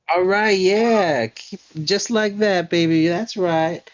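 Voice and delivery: high, slow, loud crooning coach voice; flamboyant dance teacher. Acted. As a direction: coo encouragement at your dancing pupil.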